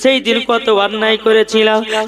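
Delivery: melodic preacher's rendering of a weeping father; imitated tearful plea. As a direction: chant the father's repentance in quick, pleading runs. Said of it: "তার আব্বা পিঠির কাছে কেঁদে বলছে". (কান্নার সুরে বাবার অনুনয়ের অভিনয়)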